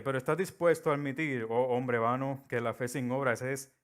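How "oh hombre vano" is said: usual speaking voice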